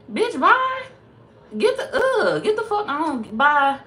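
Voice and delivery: high, loud laughing brush-off; dismissive yell. Acted. Telling fake friends to leave, a high loud laughing dismissal. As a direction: shout them out the door with a laugh.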